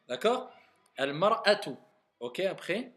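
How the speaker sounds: ordinary talk, nothing marked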